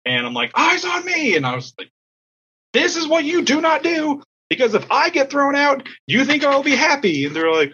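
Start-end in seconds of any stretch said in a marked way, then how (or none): 0.5-1.4 very high-pitched team call; acted-out dugout order; stern coach command. 2.7-7.2 high, emphatic telling-off; acted-out angry coach; coach's scolding. Coach scolds team in dugout.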